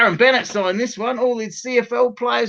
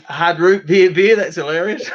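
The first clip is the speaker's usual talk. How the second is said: ordinary delivery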